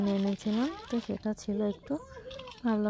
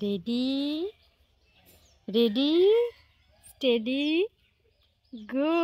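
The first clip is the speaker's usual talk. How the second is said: playful countdown; 4 semitones higher, 4 dB louder, much slower than usual. Real, not acted.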